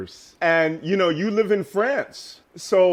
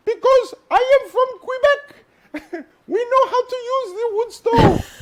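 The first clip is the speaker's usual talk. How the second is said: put-on much higher, thick Quebecois French accent, laughing through it; 3 dB louder than usual. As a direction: imitate the wife bragging in a big, funny Quebecois accent. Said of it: "funny French accent".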